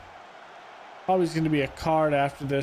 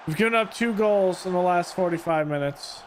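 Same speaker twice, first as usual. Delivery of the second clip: usual speaking voice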